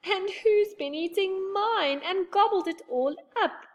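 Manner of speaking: high baby bear, hammed-up crying; weeping baby bear. A narrator's reading. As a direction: wail it like a tiny bear with an empty bowl. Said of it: "wept the baby bear".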